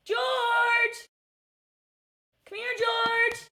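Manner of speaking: high, sung-out call to a dog. Real, not acted. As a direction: call the dog from across the house. Calling her dog.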